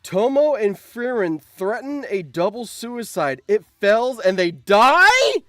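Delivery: ordinary delivery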